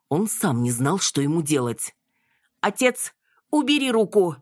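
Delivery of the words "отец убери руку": high, rising alarmed shout. A narrator's reading. Yana shouts at her father to pull back his hand. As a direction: yell a warning to her father. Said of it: "закричала Яна".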